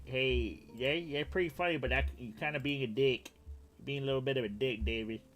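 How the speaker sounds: mock Vietnamese accent. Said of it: "And he's like".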